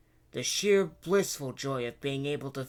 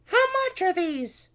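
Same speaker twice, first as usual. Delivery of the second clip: very high, loud princess voice; eager princess; 9 dB louder than usual. A narrator's reading. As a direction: ask the price as a little princess. Princess asks the price.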